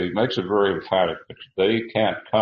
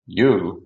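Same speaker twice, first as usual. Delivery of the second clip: "You" set apart and punched louder; "You" as a forceful, stand-alone word; emphatic YOU; 5 dB louder than usual. Retold or imitated. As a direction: lean hard on "You," then pause. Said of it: "he makes it emphatic again".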